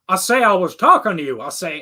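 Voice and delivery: imitating a blustery cartoon character; Foghorn Leghorn. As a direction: do a loud-talking cartoon rooster. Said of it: "I just love Foghorn Leghorn".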